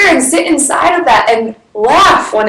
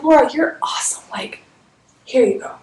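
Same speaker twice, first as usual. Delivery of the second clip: breathy imagined man's whisper, much softer; 2 semitones higher, 7 dB quieter, faster than usual. Pretend. Imagined soulmate tells Laura she's awesome, whispering. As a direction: whisper it like a secret offering. Imagined man hands her the space.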